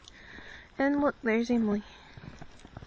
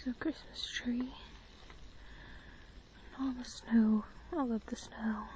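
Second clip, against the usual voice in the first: whispering; 8 dB quieter than usual. Real, not acted. Whispering to camera.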